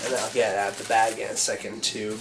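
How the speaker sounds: ordinary talk, nothing marked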